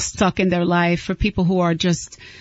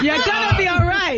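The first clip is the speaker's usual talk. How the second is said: very high, giggly reassurance; pitched way up, cracking up; laughing reassurance; 11 semitones higher than usual. Real, not acted. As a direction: sing out comfort through the laughs. Repeats cheerful reassurance amid laughter.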